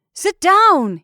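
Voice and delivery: loud, high repeated command; insistent order. A narrator's reading. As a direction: keep ordering, voice well up. Continually repeated order in boat.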